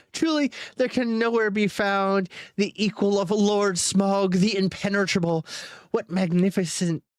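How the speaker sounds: high, fawning flattery; butter up the dragon, pitched high; high hobbit voice. A narrator's reading. Bilbo flattering Smaug, high and fawning.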